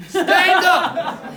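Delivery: big, loud, high retold order; shouted command. Guest speaker ordering the room up, loudly shouted. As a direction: bellow it to get a crowd up.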